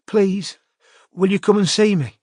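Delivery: high, small, begging voice; scared girl begging for help; pleading in fear. A narrator's reading. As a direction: beg for help, frightened. Terrified caller pleads.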